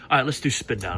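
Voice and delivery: usual speaking voice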